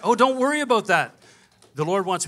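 loud reassurance. Retold or imitated. Shirley brushing off his money worry, loud and high.

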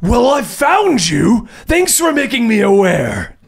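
looming villain voice; Carnage voice. Acted. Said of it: "the echoing of the Carnage voice".

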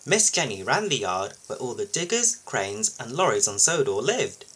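usual speaking voice

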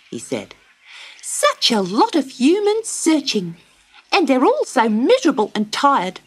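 high, light bird voice; a wagtail bird chattering kindly; small bird telling the girl the way home; chirpy little bird. Acted. Willy Wagtail speaks.

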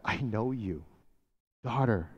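soft, low and tender; Jesus tenderly claiming the woman. Acted. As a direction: say it gently and close.

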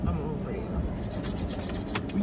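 slow, breathy whisper. Acted. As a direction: breathe it out slowly. Whispered aside.

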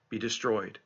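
normal talking voice

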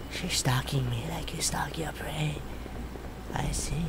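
hushed, scared whisper. Real, not acted. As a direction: whisper so she can't hear. Hiding from stalker.

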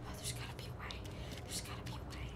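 a whispered, unvoiced musing; whispered to self. Real, not acted. Streamer stuck on a puzzle, whispering to herself. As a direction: breathe it out as a whisper to yourself.

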